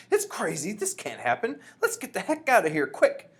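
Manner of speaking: high, loud alarmed shout; panicked shouting. A narrator's reading. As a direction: shout like someone fleeing in a panic.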